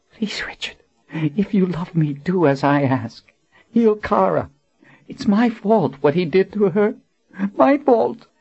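high tearful woman's voice, rising to guilt; plead, then break on my fault; desperate plea. Acted. Dying Colin begs Richard to heal Kara, guilt-stricken.

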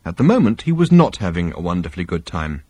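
ordinary delivery

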